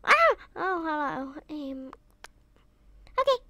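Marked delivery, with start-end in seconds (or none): none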